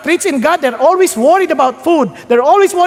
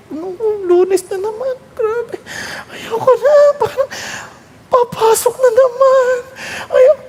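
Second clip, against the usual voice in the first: mock whine; 8 semitones higher than usual. Mocking Monday-morning whining.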